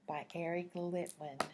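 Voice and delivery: usual speaking voice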